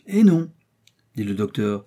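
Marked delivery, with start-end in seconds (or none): 0.1-0.5 high, loud exclaimed no; emphatic correction. A narrator's reading. Doctor corrects his two alarmed companions, emphatic exclamation. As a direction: jump up in pitch to contradict.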